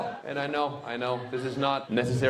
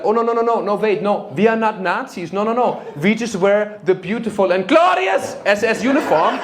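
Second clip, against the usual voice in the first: loud, higher hammed-up German accent; German reenactor; 6 semitones higher, 7 dB louder than usual. German reenactor defending the uniform, high hammed-up German accent. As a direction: protest grandly in a mock German accent.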